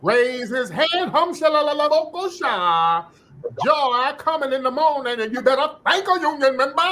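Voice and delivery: high, wide-mouthed mock church cadence; mock Southern preacher. Mocking her new accent to the audience, crazy-mouthed high preacher. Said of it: "Just doing her mouth all crazy".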